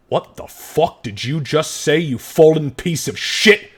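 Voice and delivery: slow, mock menacing threat; angry guard. Guard threatens Gray after his insult, slow menace.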